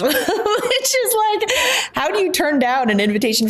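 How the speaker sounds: laughing, high and stretched out. Real, not acted. Woman recounting her wild choice to the host, laughing. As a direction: talk through a laugh, pitch jumping high.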